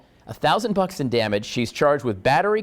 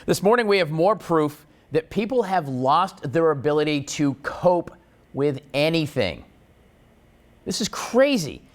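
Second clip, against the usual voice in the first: normal talking voice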